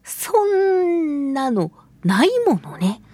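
ordinary talk, nothing marked

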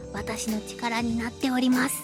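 ordinary delivery

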